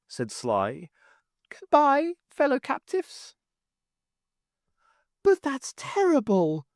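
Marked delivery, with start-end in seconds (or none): 1.5-3.3 sly goblin farewell. Acted. 5.2-6.7 high, shocked cry; shocked young voice. Acted. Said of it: "Joe cried".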